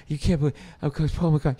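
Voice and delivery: gasping, breathless re-enactment; mock panicked gasping; hyperventilating. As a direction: gasp through the words as if hyperventilating. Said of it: "I'm hyperventilating, literally".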